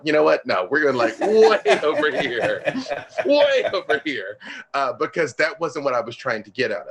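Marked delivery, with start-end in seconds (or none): 0.0-4.4 laughing, playful. Retold or imitated. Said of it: "I was like".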